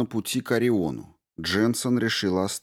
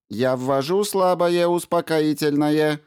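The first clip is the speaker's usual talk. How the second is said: higher, even machine voice; robot voice; 8 semitones higher, 4 dB louder than usual. A narrator's reading. Ram the robot tells the crew he is warming the module, even and mechanical. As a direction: state it like a helpful robot.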